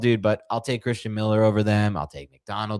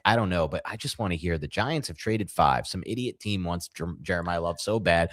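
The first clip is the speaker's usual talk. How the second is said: usual speaking voice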